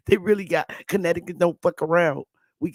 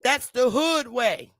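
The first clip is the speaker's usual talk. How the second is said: ordinary talk, nothing marked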